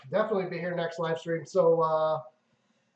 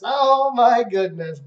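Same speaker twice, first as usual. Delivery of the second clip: high, loud, drawn-out outburst; loud exclamation; 5 semitones higher, 9 dB louder, slower than usual. Real, not acted. Host reacting to a chat message, loud drawn-out exclamation. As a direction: exclaim it long and loud, pitched up.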